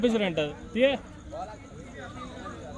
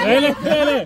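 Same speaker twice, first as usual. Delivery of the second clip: loud, high urging chant; will the ball up, shouting; excited urging; 6 semitones higher, 5 dB louder than usual. Real, not acted.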